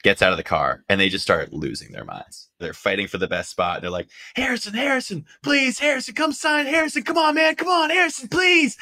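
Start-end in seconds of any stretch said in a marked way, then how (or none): 4.3-8.8 much higher, frantic pleading, rising to a shout; begging autograph hunters. Retold or imitated. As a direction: squeal for his autograph like a desperate fan.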